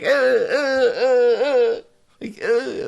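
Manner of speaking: repeated high-pitched mock yelps; imitated pained grunts. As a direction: yelp as if each word physically hurts. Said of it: "like he was physically being harmed".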